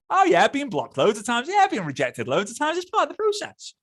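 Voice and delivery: high, singsong mock storytelling; telling blocking stories. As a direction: breezily brush off rejection in a high voice. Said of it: "tell the blocking stories".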